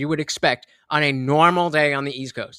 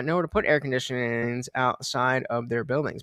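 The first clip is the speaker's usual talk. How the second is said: normal talking voice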